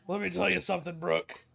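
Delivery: loud gravel-free promo shout; wrestling promo. Retold or imitated. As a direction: hype it like a wrestler. Echoing the promo catchphrase.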